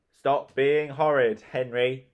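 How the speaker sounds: loud, slow scolding shout. Acted. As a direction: call out a stern warning. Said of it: "shouted Mum".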